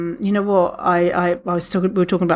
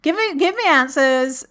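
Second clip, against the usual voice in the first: high, mock-demanding listener voice; 7 semitones higher than usual. Listener mock-demanding answers from host. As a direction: whine it like an impatient fan. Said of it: "You're like".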